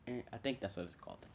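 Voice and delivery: ordinary talk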